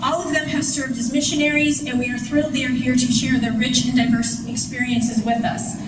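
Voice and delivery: normal talking voice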